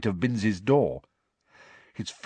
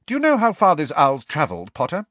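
high, loud, pointed question; sharp; 7 semitones higher, 5 dB louder than usual. A narrator's reading. Professor questioning pupil about owl's journey, sharply. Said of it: "looked sharply at Harry and said".

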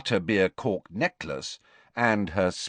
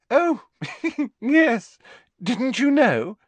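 put-on very high, girlish giggly boast; giggling; 5 dB louder, slower than usual. A narrator's reading. Hermione flaunting her date to Parvati, high giggle. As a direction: giggle through it, showily pleased and pitched up. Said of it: "with a most un-Hermione-ish giggle".

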